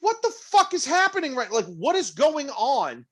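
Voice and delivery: high-pitched mock disbelief, stretched at the end; imitating baffled players protesting; incredulous dugout. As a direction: squeal it like a team in disbelief. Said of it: "just sitting there on the side going".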